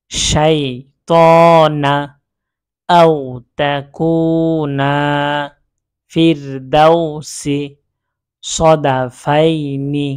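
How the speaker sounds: slow, drawn-out Arabic recitation, each word held long; Quranic recitation. Shown as a demonstration. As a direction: recite each word slowly with full tajweed, stretching the vowels. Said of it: "একটু উচ্চারণ করে পড়ি, শুধু বানান ছাড়া উচ্চারণ".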